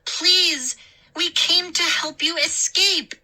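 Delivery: high, loud pleading scream. A narrator's reading. Mindy screams at the gnomes, high and loud. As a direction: scream it at the mob. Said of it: "I heard Mindy scream".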